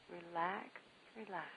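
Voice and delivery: fading, soft repeated relax; soothing relax. Acted. Host easing listeners into relaxation, softly fading. Leads a meditation.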